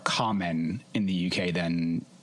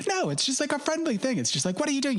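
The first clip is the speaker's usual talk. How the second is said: much higher, breezy mock-English actress; mock posh actress; much faster than usual. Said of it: "she's like".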